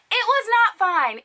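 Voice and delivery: high-pitched emphatic denial; exasperated, high-pitched correction; indignant. Real, not acted. Indignant verdict on botched wig.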